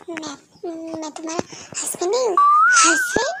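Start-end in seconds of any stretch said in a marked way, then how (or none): 2.8-3.3 squeaked much higher; startled. Acted. Wife startled at being called his wife, high squeak. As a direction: shriek the word in disbelief.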